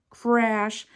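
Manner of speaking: usual speaking voice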